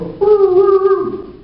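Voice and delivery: very high, very slow woo-woo siren; comic mock siren; siren imitation. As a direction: wail it out like a police siren. Cop pulls him over.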